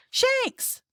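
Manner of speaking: high, urgent call; crying out. A narrator's reading. President calling into a dead radio, crying out. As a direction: call the name in alarm. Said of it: "cried the president".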